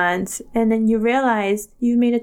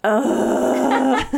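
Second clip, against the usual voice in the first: disgusted groan; 4 semitones higher than usual. Real, not acted. Disgust at pudding.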